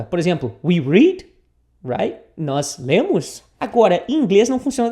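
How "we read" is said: "We read" louder, rising on "read"; question intonation. Shown as a demonstration. Teacher giving an example, "We read" with rising tune. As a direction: turn "We read" into a question by lifting the end. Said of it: "transforma ela numa pergunta".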